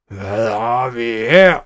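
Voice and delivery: slow, loud villain's roar; angry roar. A narrator's reading. Schnickels discovering the children in the snake, angry roar. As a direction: draw it out and swell into a roar. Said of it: "brølede han vredt".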